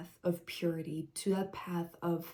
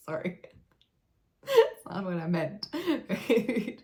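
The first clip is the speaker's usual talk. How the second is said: said through laughter, louder; laughing apology; 4 semitones higher, 3 dB louder than usual. Real, not acted. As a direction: apologize while still laughing. Laughs at her own silly voice.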